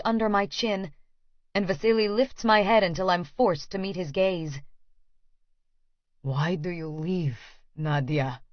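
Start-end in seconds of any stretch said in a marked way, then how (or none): 6.2-8.4 put-on slow, softer, hushed Russian accent; tender, aching Russian murmur; achingly soft. A narrator's reading. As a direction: ache through it softly, slowly, in a Russian accent. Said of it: "His voice is achingly soft".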